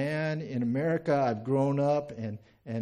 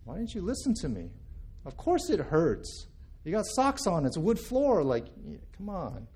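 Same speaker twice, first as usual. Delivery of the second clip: acted scolding, pitch climbing; impatient dad's I-told-you-so; exasperated parent; 4 semitones higher than usual. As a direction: lecture the kid who didn't listen. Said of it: "my response is more like".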